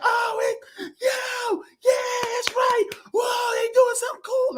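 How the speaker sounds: high, shouted fan excitement; excited fan. Retold or imitated. Imitating one fan in the theater, high excited shouting. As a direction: gush like a hyped kid in the crowd. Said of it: "this one was like".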